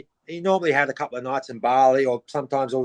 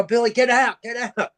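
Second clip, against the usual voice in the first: high, urgent mock call to get out; high, pressing mock yell; urgent warning; 7 semitones higher than usual. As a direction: shout the warning from a distance, retold. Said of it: "And they go".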